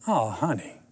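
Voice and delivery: soft, low, falling "poor thing" tone; mock sympathy, said softly; pitying. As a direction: imitate a wife gently pitying her husband. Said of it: "my wife who loves me just pitied me".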